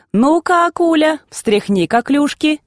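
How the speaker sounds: put-on high, bright call to a girl. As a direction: call her over, cheerfully. Townsfolk calling the girl.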